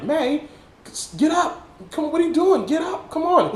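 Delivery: high-pitched imitated plea; acted anxious begging, pitched high; desperate pleading. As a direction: coax someone who won't wake. Acts out Peter pleading with Aunt May.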